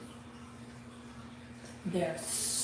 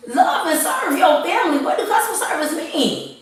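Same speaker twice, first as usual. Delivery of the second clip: preaching at full force, higher and much louder; impassioned rant; 8 semitones higher, 7 dB louder than usual. Real, not acted. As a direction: pound every line like a fired-up sermon. Heated rant to her viewers.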